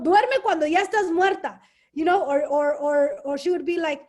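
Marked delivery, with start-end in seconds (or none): 0.0-1.6 higher, louder retold motherly scolding; lightly voiced nagging mother; mom's orders, retold with emphasis; imitating mom. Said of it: "what my mom would tell me".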